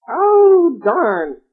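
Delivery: high, loud witch exclamation. A narrator's reading. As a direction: squawk it like an annoyed witch. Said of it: "said the witch".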